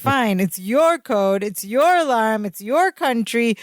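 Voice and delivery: hammed-up self-pity, drawn out; Balky's wounded speech. Balky giving up his dream of knowing a code, melodramatically wounded. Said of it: "Balky does this little, like, speech".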